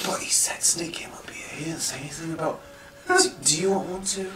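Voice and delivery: hushed, innocent whisper. Acted. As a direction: whisper it earnestly. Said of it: "He goes".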